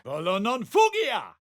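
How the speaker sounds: high defiant incantation; spell cry. Acted. Adventurer casting a spell, high cry.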